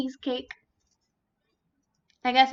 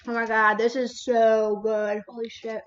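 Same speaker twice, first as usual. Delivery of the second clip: savoring; 5 semitones lower, 4 dB louder than usual. Real, not acted. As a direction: drag it out with pleasure. Tasting the cheesecake.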